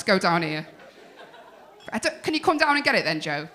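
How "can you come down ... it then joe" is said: ordinary talk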